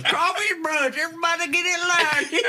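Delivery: high, loud laughing call to line up; hammed-up brunch host cracking up; laughing mock announcement. Cook mock-announcing a crawfish brunch while laughing.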